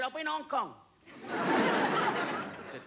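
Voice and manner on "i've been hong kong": higher, sing-song mock Chinese waiter; a comic's high put-on Chinese accent. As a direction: play the restaurant man with a put-on Chinese accent. Voicing the Chinese restaurant man.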